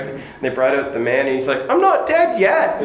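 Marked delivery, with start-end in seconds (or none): 1.7-2.8 very high, hammed-up British old-comedy voice; bad British accent. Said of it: "That's a really bad British accent".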